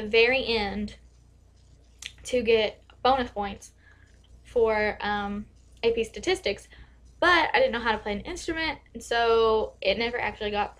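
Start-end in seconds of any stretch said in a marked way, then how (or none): none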